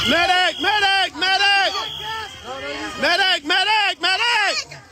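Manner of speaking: urgent high call for help; man down shout. Real, not acted. Calling for medic in crowd.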